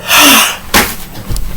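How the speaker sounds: high shocked intake of breath; hammed-up gasp; mock gasp. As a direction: gasp like caught off guard. Victim's reaction gasp.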